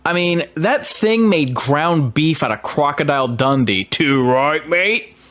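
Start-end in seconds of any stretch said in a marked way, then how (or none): 3.8-5.2 mock Aussie accent, rising at the end. Reviewer joking about Crocodile Dundee's fate, mock Aussie accent. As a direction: put on a playful Australian accent. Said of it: "That's a pretty good Aussie accent".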